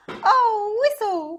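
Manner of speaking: high, loud dismayed cry; little engine crying out at the curve; cried out. Acted. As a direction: cry out in sudden alarm. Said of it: "cried Tootle".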